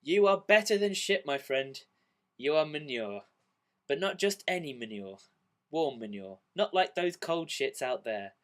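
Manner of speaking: loud, grand mock-solemn consolation; drunk pep talk. Drunk in a pub, comforting a down friend, hammed-up solemn. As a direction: deliver it like a proud toast. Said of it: "I responded with this classic, absolutely brilliant quote".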